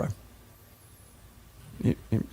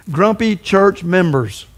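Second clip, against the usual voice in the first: very slow, deliberate naming; slow emphatic label; spelled out slowly; 3 semitones higher, much slower than usual. Shown as a demonstration. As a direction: draw out each word of the label. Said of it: "He called them G C Ms".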